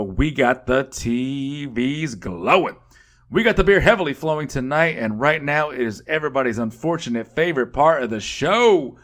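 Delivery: ordinary talk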